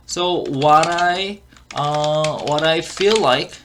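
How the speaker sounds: very slow, word-by-word teaching delivery; slow model reading. Shown as a demonstration. Teacher repeats the clip for learners, slow model reading. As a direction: stretch each English word out slowly.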